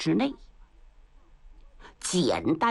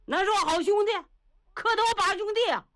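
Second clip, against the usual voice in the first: high-pitched, welling-up distress; tearful grief; 10 semitones higher, faster than usual. A narrator's reading. Old Niu remembers his sworn brother, tearfully upset. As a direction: plead not to speak of it, voice pitched up in grief. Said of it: "眼圈当时红了".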